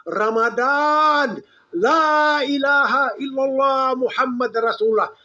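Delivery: high, very slow, drawn-out shahada; each word laid down deliberately; slow solemn declaration. Retold or imitated. Wife finally says the shahada.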